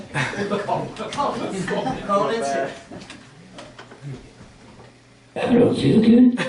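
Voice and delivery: lightly done soul-singer croak; sing-shout a sick call like a soul singer. Pretend. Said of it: "impression of [name] calling in sick".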